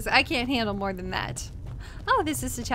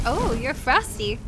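ordinary talk

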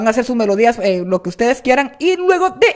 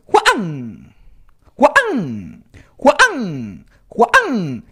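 slow, cracked, drawn-out name; scratch break; 3 semitones lower, much slower than usual. Shown as a demonstration. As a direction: break "Juan" mid-vowel and let it fall. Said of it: "pónganle con su nombre".

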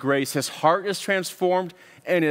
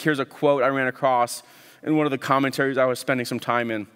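normal talking voice

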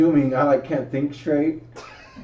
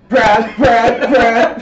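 calling a name louder and higher, in a panic; panicked calling out; 8 semitones higher, 7 dB louder, much slower than usual. Retold or imitated. Wife calling after him as he storms back, panicked and louder. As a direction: shout his name in alarm, louder and higher. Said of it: "She's, like, panicking".

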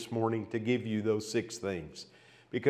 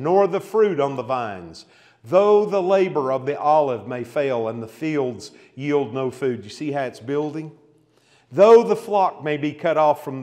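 building scripture reading; 5 dB louder than usual. Acted. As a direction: let each line climb to the climax. Reads Habakkuk, building to climax.

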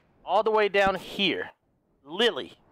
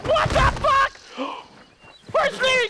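high startled yelp of what the fuck; jolted into a high shocked cry; sudden high shriek of surprise; shocked outburst; 4 dB louder than usual. Real, not acted.

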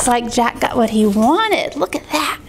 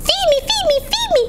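put-on very high demanding baby voice; crying baby duck; much faster than usual. Hungry duckling to Jack, high baby cry. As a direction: squeal for food, tiny and high. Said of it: "cried the hungry baby duck".

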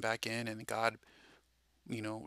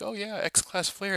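mock casual; 10 semitones higher, 5 dB louder, slower than usual. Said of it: "and they're like".

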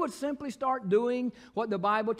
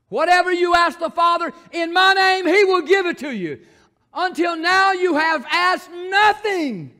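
loud, high shouted scripture; preaching at full force; 7 semitones higher, 10 dB louder than usual. Acted. Preacher shouting the verse to the congregation, full force. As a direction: thunder the verse at the church.

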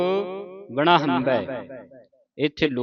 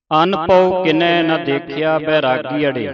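slow, louder sung-speech recital of the shloka; gurbani recitation; 2 semitones higher, 6 dB louder, slower than usual. Acted. Granthi reciting Kabir's shloka to the sangat, slow and louder. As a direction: recite it slowly like paath.

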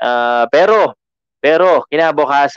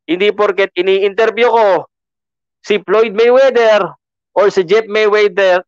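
excited protest; 7 semitones higher than usual. Real, not acted. Defensive about Mayweather interviews.

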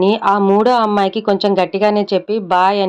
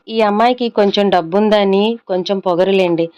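ordinary delivery

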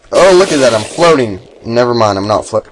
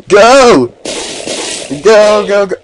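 high, loud urgent cheers; excited shout; 10 semitones higher, 3 dB louder, slower than usual. Real, not acted. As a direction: yell go at the screen. Urging character on.

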